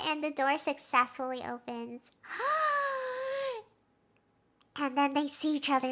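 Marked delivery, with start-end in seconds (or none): 2.3-3.6 long, high drawn-in gasp; hammed-up gasp of amazement; dramatic gasp. Recapper reacting as the door opens, dramatic gasp.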